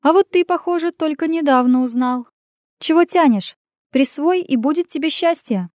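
teasing woman's voice, pitched well up; purring tease. A narrator's reading. Woman urging a hesitant man to act, teasing purr. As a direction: coax him knowingly, high and playful. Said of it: "подмигнув, проурчала".

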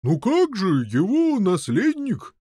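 high, mildly baffled reply; somewhat puzzled. A narrator's reading. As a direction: answer as if a bit taken aback. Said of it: "ответил несколько озадаченный сэр Груммар". (несколько озадаченно)

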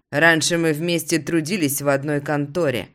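plain reading voice

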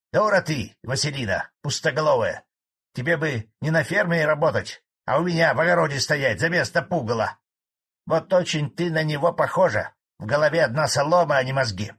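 громче и выше, злая брань старика; обругай её сердито; бранится. A narrator's reading. Старик ругает Василину. (старик сердито ругает Василину)

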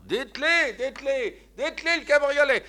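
shouting from far off across the way; calling from afar. A narrator's reading. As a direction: hail the coachman from far down the street. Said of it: "On l'entend de loin".

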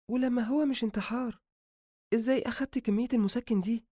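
high-pitched woman asking; high, probing female character; female doctor's voice. Acted. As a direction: question him in a high female voice. Woman questions patient.

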